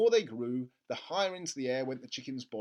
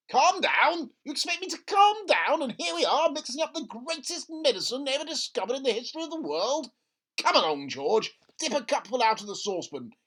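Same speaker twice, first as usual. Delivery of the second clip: high, loud and indignant; too thrilled to calm down; an incredulous dad, high and loud; outraged excited father; 9 semitones higher, 8 dB louder than usual. Acted. Father voiced in story.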